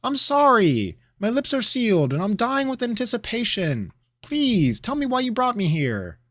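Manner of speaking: high, loud eager plea; pleading. Acted. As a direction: beg him to go on. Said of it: "Rabineau pleaded".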